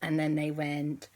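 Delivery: plain reading voice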